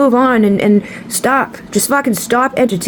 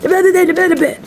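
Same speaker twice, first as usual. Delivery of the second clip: mock yelling; 9 semitones higher than usual. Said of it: "I was, like, yelling at them".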